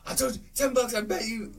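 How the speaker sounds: high-pitched crowing over a bet; mock gloating. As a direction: imitate someone claiming a won bet. Voicing character crowing over a bet.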